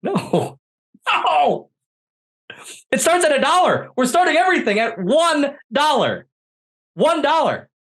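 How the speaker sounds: high, emphatic, insistent; excited high protest. Real, not acted. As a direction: pitch way up defending it. Defending Whatnot pricing.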